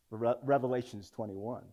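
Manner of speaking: normal talking voice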